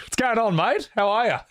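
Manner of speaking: high, chirpy Aussie hello; playful Australian accent greeting. Acted. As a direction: greet a mate in a bright Australian twang. Mock Aussie greeting to Pigu.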